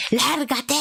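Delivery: mock demon shout; demon yell. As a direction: bark it like a demon. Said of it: "el demonio le dice".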